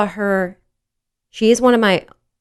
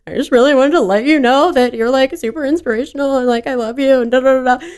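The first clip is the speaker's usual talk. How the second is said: gushing fan; 7 semitones higher than usual. Retold or imitated. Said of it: "And I was like".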